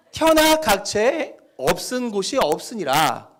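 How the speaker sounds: slow, loud North Korean dialect. Shown as a demonstration. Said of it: "북한 사투리를 쓴다고 생각하고 읽으시면".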